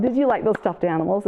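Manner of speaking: ordinary delivery, nothing marked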